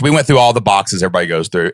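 usual speaking voice

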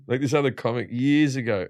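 ordinary delivery

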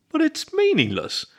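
high, drawn-out protest. A narrator's reading. Wolf objects to the raccoon keeping his token, protesting. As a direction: protest slowly, pitched well up. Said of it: "Jay protested".

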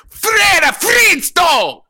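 high slow shouted fake Italian; loud Italian accent. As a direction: bellow it like a cartoon Italian.

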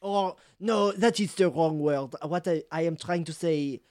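put-on flustered French-accented backtracking. Cheese seller correcting his insult, French accent. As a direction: search for the word in a French accent. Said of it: "French accent and all".

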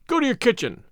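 understated command. A narrator's reading. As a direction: order a servant off, mildly stern. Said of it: "Captain Ramon commanded".

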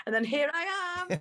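high, rising sing-out; playful punchline. Real, not acted. Sheepish punchline to own story.

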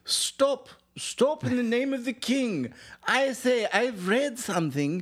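high, drawn-out cry to a crowd; hammed-up high town crier; mock official proclamation. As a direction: call out pompously in the king's name. Acted official calling to rioters.

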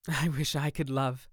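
a touch of longing pathos. A narrator's reading. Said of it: "with a deep note of pathos in his voice".

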